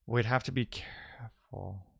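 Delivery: ordinary talk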